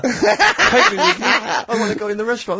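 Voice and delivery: mock wail of a little kid, laughing; mock child wailing to be let out, laughing; pleading child. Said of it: "little kid with his face pressed".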